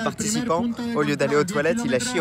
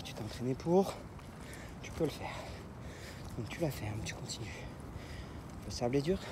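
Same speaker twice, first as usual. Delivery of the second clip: quiet self-encouragement; 2 semitones lower, 11 dB quieter than usual. Real, not acted. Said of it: "self-coaching on the hard climb".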